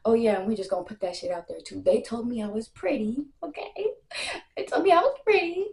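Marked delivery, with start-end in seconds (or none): 3.4-5.7 excited. Real, not acted. Bragging about being called pretty.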